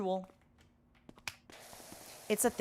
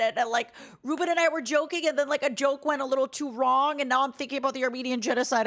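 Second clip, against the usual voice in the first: normal talking voice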